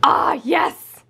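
breathy excited cheer; triumphant. Real, not acted. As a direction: celebrate the win. Triumphant reaction.

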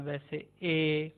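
"ए" drawn out slowly, as in red; slow E demo. Shown as a demonstration. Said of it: "जैसे रेड के अंदर". (धीरे से "ए" रेड जैसा)

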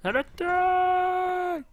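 long, very high drawn-out charge yell; hammed-up shout to attack; battle cry. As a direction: scream the charge like a general.

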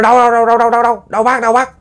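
high, rapid-fire questioning; urgent searching call. Retold or imitated. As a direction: call out anxiously while searching. Searchers call back to the man. (hỏi dồn, giọng cao hối hả)

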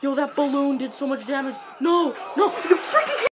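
high, loud cries rising to a scream; desperate shouting. Real, not acted. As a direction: scream in agony as the win slips away. Losing just short of winning.

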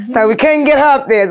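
ordinary talk

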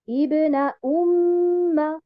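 ibna umma very slowly, each syllable drawn out; slow model. Shown as a demonstration. Teacher models ibna umma slowly for students.

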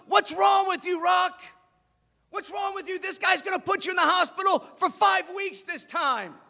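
high, loud berating of a fighter; exasperated yelling. Retold or imitated. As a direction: yell at him to wake up. Apollo berating Rocky.

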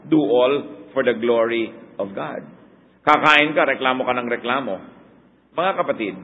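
ordinary delivery

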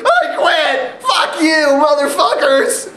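hammed-up furious quitting shout, half laughing; Batista mock shout. A wrestler in a wheelchair quitting on the crowd, mock yelling. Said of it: "Batista sitting in a wheelchair going".

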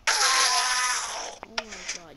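loud startled cry; startled shout. Real, not acted. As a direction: jump at a sudden fright. Jump scare in game.

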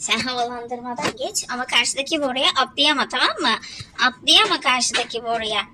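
shouting at the game; loud frustrated instructions. Real, not acted. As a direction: loudly order the character across. (oyuna bağırarak talimat)

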